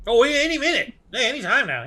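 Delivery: high, loud in-character outburst; acted eager prisoner. Prisoner answering his captors about the boat, high and eager.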